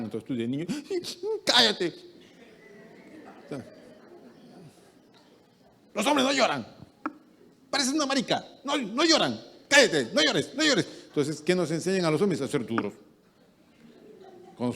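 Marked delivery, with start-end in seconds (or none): 1.5-1.9 higher, louder sharp order; a parent hushing a little boy; scolding parent. Retold or imitated. 5.9-10.9 high, loud scolding; a parent shaming a son for crying. Retold or imitated.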